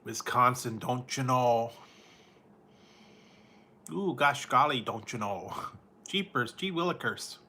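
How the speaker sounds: hammed-up Wisconsin Upper Midwest lilt; mock Wisconsin accent. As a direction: ham up a Midwest Wisconsin local. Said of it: "stereotypes that they like to say".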